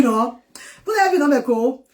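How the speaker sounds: normal talking voice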